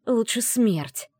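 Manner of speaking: slow, hoarse defiance; hoarse rasp. Acted. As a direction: rasp it out slowly. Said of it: "прохрипела я".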